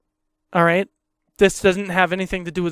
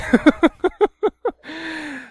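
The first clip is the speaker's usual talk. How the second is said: squeaky burst of laughter; high laugh; 9 semitones higher than usual. Real, not acted. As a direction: laugh high after the joke. Laughing at own mimicry.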